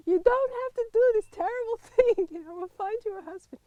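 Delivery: high, laughing retelling of the mother's plea; mock the mother's words while laughing; laughing mock quote. Retelling friend's mother's plea to a friend, laughing mockery. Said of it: "It was so typical".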